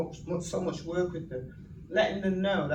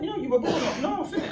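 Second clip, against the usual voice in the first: imitating the minister; 11 semitones higher, 3 dB louder, faster than usual. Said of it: "He went".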